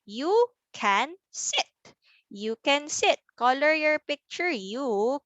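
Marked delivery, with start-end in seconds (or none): 0.0-1.7 slow, with gaps between the words; word-by-word reading. Shown as a demonstration. Teacher models reading for children, word by word. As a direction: read each word on its own. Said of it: "how about we read our sentence".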